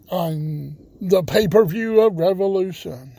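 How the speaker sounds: upbeat radio show announcer; radio host intro. Acted.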